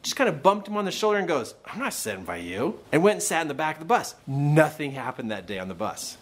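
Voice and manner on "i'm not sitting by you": low, quiet brush-off; imitated bully, voice dropped and soft; confused bully. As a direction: mumble it like a thrown-off bully. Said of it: "was in a confused way".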